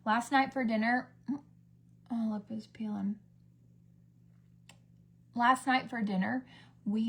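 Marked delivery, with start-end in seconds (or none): 1.3-3.2 muttered unvoiced aside; whisper. Real, not acted. Noticing her peeling lip mid-story, whispered aside. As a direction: whisper it to yourself.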